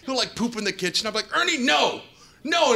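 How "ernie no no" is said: high-pitched mock telling-off; puppy scolding. Owner catching his cute pug pooping, high mock scolding. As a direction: scold a puppy without real anger.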